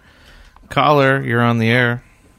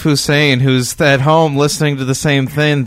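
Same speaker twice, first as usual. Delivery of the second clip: ordinary talk, nothing marked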